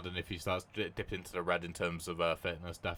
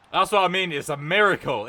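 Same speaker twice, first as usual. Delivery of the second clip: louder, higher told-you-so exclaim; excited vindication; 8 semitones higher, 10 dB louder than usual. Real, not acted. Gamer gloating after the lucky goal, loud excited exclaim. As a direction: crow that he was right.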